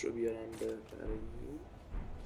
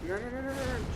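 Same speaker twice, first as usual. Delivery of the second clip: panicked; 12 semitones higher than usual. Real, not acted. Panicking in boss fight.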